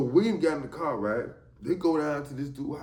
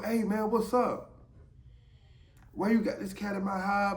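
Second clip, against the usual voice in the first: angry confrontation; 9 semitones higher than usual. Retold or imitated. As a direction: call out through the door like you caught them. Boyfriend bangs on the door.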